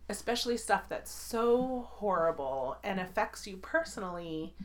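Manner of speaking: ordinary talk, nothing marked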